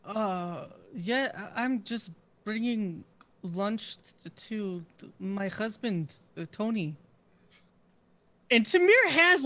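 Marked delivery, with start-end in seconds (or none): 0.0-6.9 quiet, low, halting reply; hesitant excuse. Acted. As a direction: stall softly while making up a cover story. Caught, makes up an excuse.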